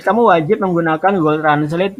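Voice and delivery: ordinary delivery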